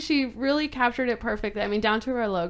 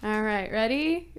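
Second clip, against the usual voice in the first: normal talking voice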